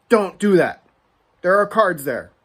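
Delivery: loud mock shopkeeper yell; retold loud warning from the shopkeeper. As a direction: bark it like a grumpy clerk. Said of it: "he yells at me and says".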